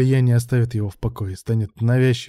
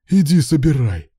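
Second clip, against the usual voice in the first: loud, higher-pitched dare; angry retort; 4 semitones higher, 7 dB louder than usual. A narrator's reading. Boss defies threat of the board, angry. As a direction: throw the threat back at him.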